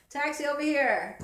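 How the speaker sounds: loud high call across a street; hailing a taxi. Pretend. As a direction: flag down the cab, shouting. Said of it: "I'm talking to my light".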